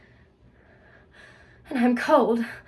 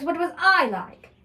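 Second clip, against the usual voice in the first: put-on childlike plea; 5 semitones higher than usual. Pleading as a little girl.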